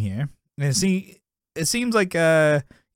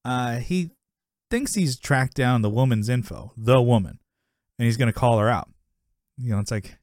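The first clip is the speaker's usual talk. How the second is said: usual speaking voice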